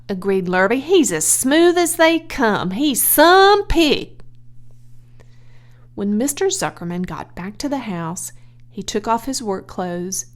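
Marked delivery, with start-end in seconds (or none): 0.8-4.2 higher, louder hired man, slowing on some pig; the farmhand echoing the boss eagerly. Acted.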